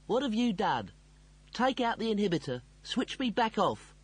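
high-pitched agitated ranting. A narrator's reading. Computer demanding to be switched off, ranting. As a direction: rant in urgent, high demands. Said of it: "to silence the ranting computer".

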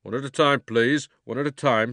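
calm the crowd, one at a time; flustered policeman. A narrator's reading. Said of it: "said the policeman".

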